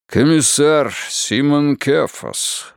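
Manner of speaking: slow, lightly voiced giant's greeting; a hint of a booming host; rumbling giant. A narrator's reading. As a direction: roll it out slowly, like a big man. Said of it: "пророкотал великан".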